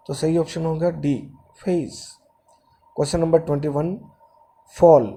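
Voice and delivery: normal talking voice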